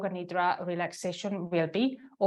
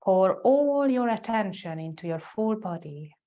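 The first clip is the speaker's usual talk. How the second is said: guided meditation. Real, not acted. Yoga teacher guiding students into the heart center, guided meditation. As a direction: lead them inward, leaving space after each phrase.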